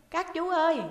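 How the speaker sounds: put-on high, loud child's call across the cells. A narrator's reading. Boy calls through the cell wall, high and loud. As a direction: call out to the teachers in the next cell. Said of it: "nó lại nheo nhéo".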